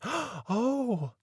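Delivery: a sudden discovery; surprised exclamation. A narrator's reading. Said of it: "she exclaimed".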